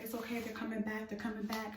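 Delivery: hushed and even, settling someone down; calm reassurance. Retold or imitated. Boyfriend reassuring her about the ambulance, calm reassurance.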